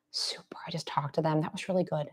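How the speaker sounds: very soft check-in voice; soft praise. Acted. Softly praising dog at check-in.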